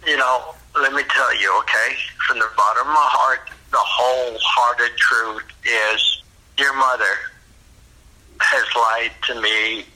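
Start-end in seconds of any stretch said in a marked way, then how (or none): none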